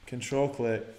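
ordinary talk, nothing marked

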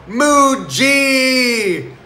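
high, loud yelled call. Retold or imitated. Pranking the serious meditators, a high loud yell. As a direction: throw it out over the whole crowd. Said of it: "I put up my hands and I yell".

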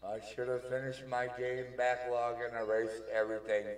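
loud regret. Acted. Neptune lamenting her supposed death.

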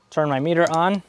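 normal talking voice

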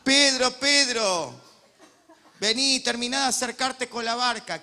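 loud call with a laugh; calling Peter from the shore. Acted. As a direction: shout to Peter in the boat.

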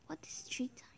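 puzzled whisper. Real, not acted. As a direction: whisper the question softly. Puzzled aside.

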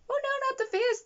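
shrill panicked robot begging for its face; droid pleading. Retold or imitated. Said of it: "like, just".

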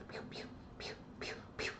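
tiny whispered pew sounds; whispered gunshots. Shown as a demonstration. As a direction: breathe the pews out barely audible. They're just straight up like.

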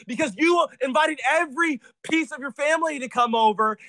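high-pitched, heated shouting; angry outburst. Real, not acted. Answering parent's complaint about five cats, angry outburst. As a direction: fire back indignantly, voice pushed up high. Angry retort in family quarrel.

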